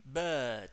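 "bird" with a held central E, no R; long E sound. Shown as a demonstration. Teacher models for the class, "bird" with long E sound. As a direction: stretch the vowel in "bird", R silent. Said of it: "Ese es el sonido de la E larga".

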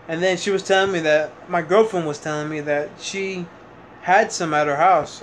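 ordinary delivery

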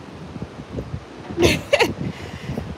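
airy unvoiced giggle; breathy laugh. Real, not acted. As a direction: let out a soft breathy laugh. Laugh after the greeting.

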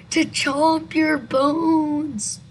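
loud, drawn-out ghoulish threat; eerie creature punchline; spooky creature. Acted. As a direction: stretch it slowly into a wail. Creature answers in the story.

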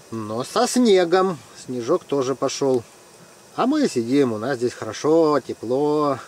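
normal talking voice

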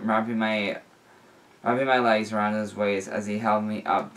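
ordinary delivery

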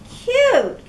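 high, loud scoffing; snickering scorn. Acted. Katisha scoffs at Cornelius, high and loud. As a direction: scoff at the word. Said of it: "Katisha snickered".

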